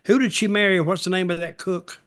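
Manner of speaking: ordinary talk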